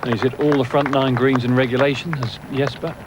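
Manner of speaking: ordinary delivery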